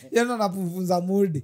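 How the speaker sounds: laughing in disbelief. Real, not acted. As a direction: chuckle through the words. Amazed laughter about cousin.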